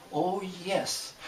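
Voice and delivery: ordinary talk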